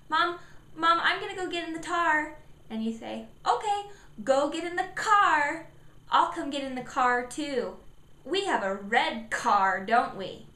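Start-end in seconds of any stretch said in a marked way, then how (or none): 0.1-2.4 put-on higher little-kid voice, "tar" for car; child calling mom. Shown as a demonstration. Child telling Mom about the car, high voice. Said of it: "So he says". 3.5-10.5 brightly repeat, punching "car" each time; modeled K sound. Shown as a demonstration. Parent answering child, modeling "car" with emphasis. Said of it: "three times that I modeled the same way".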